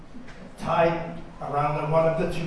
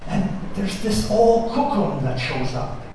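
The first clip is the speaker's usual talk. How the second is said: ordinary delivery, nothing marked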